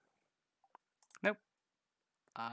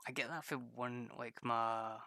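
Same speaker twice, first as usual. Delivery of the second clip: normal talking voice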